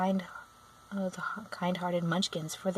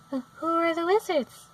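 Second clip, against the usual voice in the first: put-on higher, slow curious girl; young girl voice; 10 semitones higher, 3 dB louder, slower than usual. A narrator's reading. Dorothy asks the witch about wizards: a high curious girl. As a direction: ask it wide-eyed like a child.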